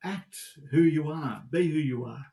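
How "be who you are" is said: ordinary delivery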